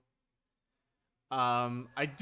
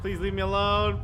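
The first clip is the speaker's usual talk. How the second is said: loud, higher desperate plea; pleading scream; 7 semitones higher, 10 dB louder than usual. Real, not acted. Player begs the chasing enemy, loud and scared. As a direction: beg the monster to stop chasing.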